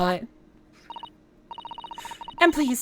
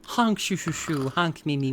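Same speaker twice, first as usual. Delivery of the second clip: cartoon snoring; 6 semitones lower, 4 dB quieter, slower than usual. Imitating a cartoon snore.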